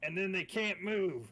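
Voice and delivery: loud, high in-character explanation; shouting back. Acted. Player explaining the trap to Casimir, shouting back. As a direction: holler it over to someone far off.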